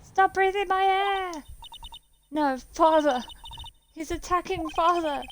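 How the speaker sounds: put-on very high, frightened child's cries; a small boy watching his father attacked; terrified child.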